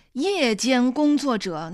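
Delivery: 夜间工作者 said slowly, every syllable weighted; stressed night worker. Acted. Mother jabs at her daughter's night job, slow heavy stress on 夜间工作者. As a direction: lean hard and slow on 夜间工作者. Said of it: "把夜间工作者用重音读出来".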